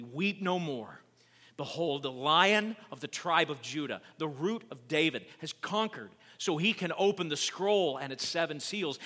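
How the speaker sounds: loud, emphatic declaration; dramatic scripture reading. A narrator's reading. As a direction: announce the victory with rising force.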